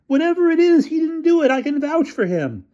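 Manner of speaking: high, pleading defense; pleading student. A narrator's reading. Bruno vouching for his friend, high and pleading. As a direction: stand up for a friend. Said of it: "bellowed Bruno".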